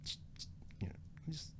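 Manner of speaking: whispered, halting caution to a companion; hushed retold pleading. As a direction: act out quietly shushing a friend. Quietly urging Travis to calm down.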